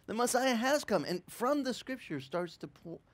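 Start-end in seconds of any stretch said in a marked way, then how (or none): 0.0-1.0 high, bright-eyed announcement to everyone; preacher excitedly announcing the Messiah to a crowd, high pitched; eager proclaiming. Retold or imitated. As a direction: call out the news like a thrilled herald. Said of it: "starts proclaiming to everyone".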